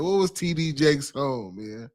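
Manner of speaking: ordinary delivery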